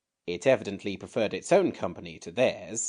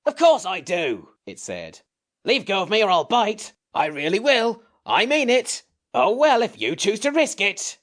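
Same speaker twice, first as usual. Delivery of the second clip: higher, louder cross creature voice; grumpy sand creature; 13 semitones higher, 8 dB louder than usual. A narrator's reading. The Samiad protests being held, grumpy creature voice. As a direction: snap like a cranky little beast.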